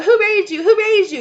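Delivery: loud, very high mocking yell; hammed-up shrieking scold. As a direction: parrot the scolding elders in a shriek. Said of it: "sit around and go".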